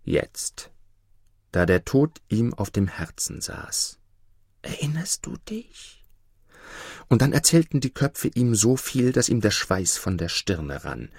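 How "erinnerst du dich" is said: high eerie whisper; ghostly whisper. Acted. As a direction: breathe it like a haunting head at the bedside. Ghostly heads speak to the emperor.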